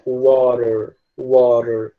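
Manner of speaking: slow "water" with the T tapped like an R; American T like R. Shown as a demonstration. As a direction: say "water" American-style, T softened to a flap, final R sounded. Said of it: "muito mais semelhante a um R".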